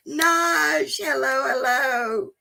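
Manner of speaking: high, slow sing-song greeting to a dog; pet talk. Real, not acted. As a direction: coo hello to the dog, drawn out. Greeting her dog on arrival.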